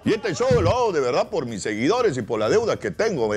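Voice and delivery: usual speaking voice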